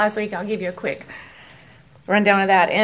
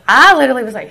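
ordinary talk, nothing marked

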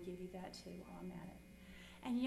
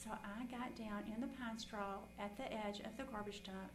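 usual speaking voice

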